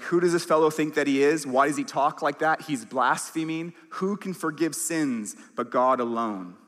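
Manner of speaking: indignant teachers. Retold or imitated. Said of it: "They're really worked up over this".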